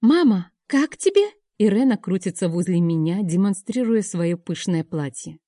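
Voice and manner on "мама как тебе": put-on high excited child's voice; a small girl showing off her dress, high; little girl. A narrator's reading. Daughter twirls in her dress asking her mother, high child voice.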